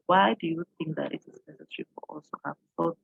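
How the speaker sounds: ordinary delivery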